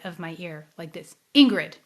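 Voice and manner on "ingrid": sudden loud imitation of a voice; voice calling name. Imitating a voice outside her ear, a sudden loud call. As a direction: call the name out abruptly, much louder. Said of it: "I'd hear a voice say my name".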